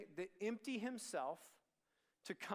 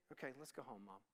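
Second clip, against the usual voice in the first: lower, softer; gentle yielding; 7 semitones lower, 9 dB quieter, faster than usual. Acted. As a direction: quietly give in to mom.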